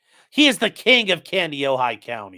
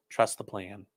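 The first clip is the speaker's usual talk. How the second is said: softer, quiet repeat of the slogan; mock hushed slogan; 6 semitones lower, 10 dB quieter than usual. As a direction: let it fall soft like a secret. Mocking conspiracy slogan, repeated.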